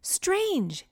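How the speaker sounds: understated high yell; mild yell. A narrator's reading. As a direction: call it out high without much volume. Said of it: "Teo yelled".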